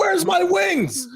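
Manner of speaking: very high, slow, hammed-up yell; joking gunman yelling for his wings; mock shout. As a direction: wail for the wings, laughing.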